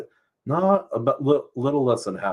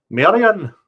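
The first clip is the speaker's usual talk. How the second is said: much higher, loud call of a name; 12 semitones higher, 11 dB louder than usual. Acted. Character calling Marian, a loud high call. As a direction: shout the name across the room. Character calls out in read scene.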